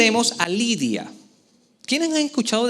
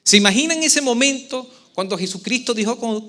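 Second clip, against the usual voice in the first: normal talking voice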